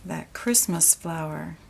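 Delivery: usual speaking voice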